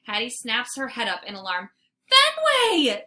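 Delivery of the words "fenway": high, loud alarmed shout. Acted. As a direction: call the dog's name in alarm. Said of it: "she shouts".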